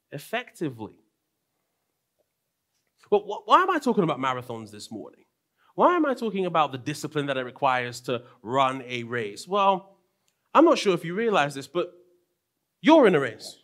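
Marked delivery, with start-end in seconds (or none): none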